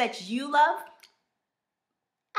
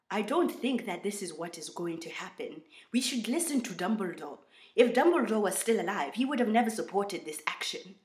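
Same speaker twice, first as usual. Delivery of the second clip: put-on British meets African accent, measured delivery; attempted African-British accent; Kingsley Shacklebolt, British-African accent. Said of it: "I'm going to try a Kingsley Shacklebolt accent".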